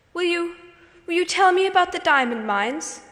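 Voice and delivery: high, pleading little whimper. A narrator's reading. Lottie begging Sarah for a story, high and whimpering. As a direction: beg it like a sniffling child. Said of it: "whimpered Lottie".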